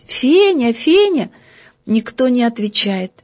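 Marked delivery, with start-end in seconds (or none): 0.1-1.3 calling her name, higher. Acted. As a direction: call out across the room. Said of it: "стал кричать". (зовёт, повышая голос)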